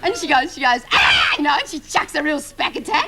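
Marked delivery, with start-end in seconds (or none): 0.9-1.4 short imitated scream; mock yelp from sitting on a pin; retold yelp of pain. Acting out classmate sitting on pin.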